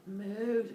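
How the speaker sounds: small, high moo; cow moo. Acted. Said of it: "And the cows are like".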